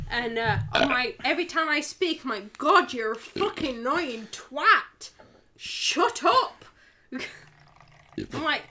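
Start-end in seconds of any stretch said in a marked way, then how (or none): 2.6-6.7 slow berating, rising on "Shut up"; exasperated self-scolding. Retold or imitated. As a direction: tell yourself off in mock fury. Scolding herself in acted quote.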